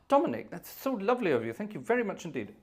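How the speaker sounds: high theatrical gratitude; gasping delight. Pretend. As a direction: gush over a donor. Thanking donor with gasp.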